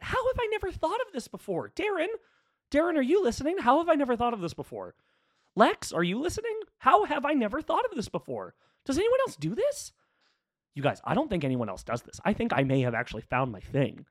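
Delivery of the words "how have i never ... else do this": high, thrilled shouting; excited. Real, not acted. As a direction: burst with giddy excitement. Excited at new podcast idea.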